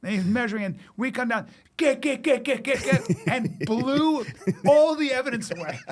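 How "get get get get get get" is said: higher, louder chopping imitation of rotor blades; chopper noise imitation. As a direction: chop it out like spinning rotor blades.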